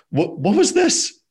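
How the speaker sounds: high, drawn-out puzzled question; mock the family's shared confusion; baffled. Family looking at each other, puzzled. Parents' baffled look, retold.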